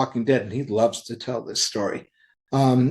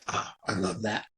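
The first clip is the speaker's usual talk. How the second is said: soft, lower, heartfelt reaction; touched; 4 semitones lower, 9 dB quieter than usual. Retold or imitated. Publisher imagines hearing a father-and-son pitch, touched. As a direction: say it quietly, moved by the idea.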